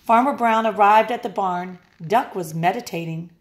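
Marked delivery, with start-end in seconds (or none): none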